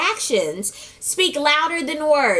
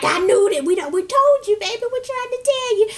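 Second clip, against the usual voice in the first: higher, pleading grandma; 9 semitones higher than usual. Retold or imitated. Grandma comforting Amber, high and pleading. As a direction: play the knowing grandma, pitched up.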